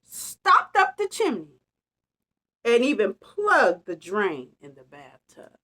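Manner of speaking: ordinary delivery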